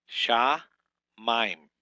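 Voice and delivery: Sha, mime. said as two loud separate beats with a pause between; syllable-by-syllable teaching demo; split into syllables. Shown as a demonstration. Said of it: "it's two syllables".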